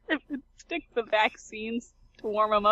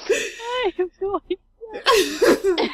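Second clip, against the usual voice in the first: laughing; 8 semitones higher, 6 dB louder, much slower than usual. Real, not acted. Laughing fit.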